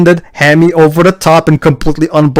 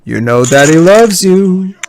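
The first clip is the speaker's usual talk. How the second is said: creepy father voice; 4 semitones higher, slower than usual. Retold or imitated. Quoting the abusive father.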